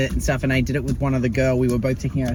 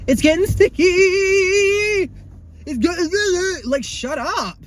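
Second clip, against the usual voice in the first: much higher, mocking then snapping shut up; exasperated outburst; 13 semitones higher than usual. Retelling the classmate's sticky complaint, high and exasperated. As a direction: mimic her whine, then cut her off.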